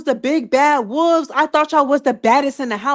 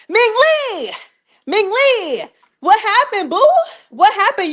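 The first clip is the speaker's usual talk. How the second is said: higher, calling the name across the room; mock call-out; 8 semitones higher than usual. Vlogger calling out Ming Lee mockingly, higher.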